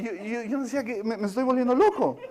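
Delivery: ordinary talk, nothing marked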